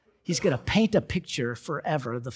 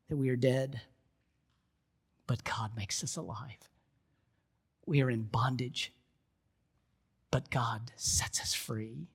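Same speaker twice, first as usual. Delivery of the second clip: soft, low, measured preaching; quiet, earnest closing exhortation; hushed sermon appeal; 3 semitones lower, 7 dB quieter than usual. Real, not acted. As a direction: bring the congregation gently to the point, with long pauses.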